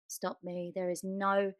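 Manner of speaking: ordinary talk, nothing marked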